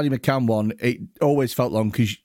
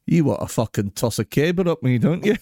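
put-on Scottish accent; 3 semitones higher than usual. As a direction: lean into a mock Scottish accent. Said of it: "Your Scottish accent is outrageous".

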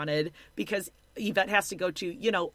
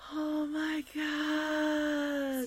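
high, very slow baby-voiced gush; mocking Mohammed; 8 semitones higher, 5 dB quieter, much slower than usual. Hosts mocking a reality star: slow, high baby gush. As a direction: coo it like an overgrown baby. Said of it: "all we really have to say about him is".

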